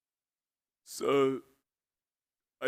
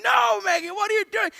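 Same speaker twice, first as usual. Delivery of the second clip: very high, loud frantic shout; hammed-up shrieky outburst; panicked exasperation; 9 dB louder than usual. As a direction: demand an answer in utter alarm. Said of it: "We're panicking at the robots".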